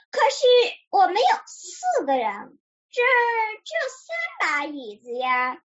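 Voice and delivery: starts high, then settles in mild puzzlement; puzzled. A narrator's reading. Bear counts players against chairs, mildly puzzled. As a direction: object with a touch of confusion. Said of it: "小熊不解地说".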